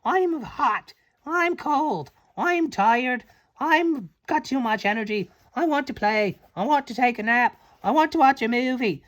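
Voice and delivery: high, whiny list of wants; a high, needy baby frog demanding everything. Acted. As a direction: whine like a cranky little frog. Voicing the never frogs' demands.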